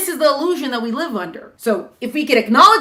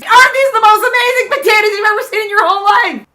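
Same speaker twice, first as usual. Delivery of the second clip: proud excited farmer; 12 semitones higher, 9 dB louder than usual. Acted. Farmer proudly presenting potatoes.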